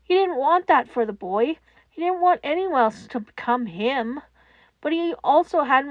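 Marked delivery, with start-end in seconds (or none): none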